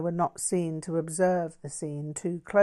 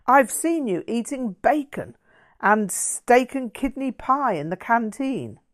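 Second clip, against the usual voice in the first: higher, louder, self-righteous accusation; righteous zeal; 6 semitones higher, 6 dB louder than usual. Acted. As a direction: lay out the proof with smug moral zeal. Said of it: "stated with evident righteous zeal".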